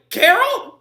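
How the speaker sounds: delighted to bump into her; surprised greeting. Acted. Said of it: "And they were like".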